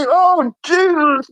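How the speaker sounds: high, drawn-out groan of dread; hammed-up appalled reaction; disgusted dismay. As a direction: brace against a stench. Acting out walking through stench.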